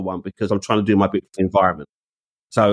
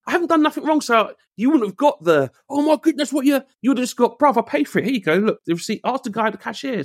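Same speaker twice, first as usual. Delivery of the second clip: high, heated and indignant; heated rant; 11 semitones higher than usual. Real, not acted. To the friend about the security guard, heated and high. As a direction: vent it to a friend, pitch climbing.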